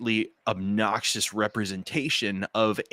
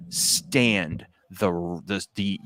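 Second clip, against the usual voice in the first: normal talking voice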